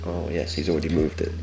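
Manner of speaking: usual speaking voice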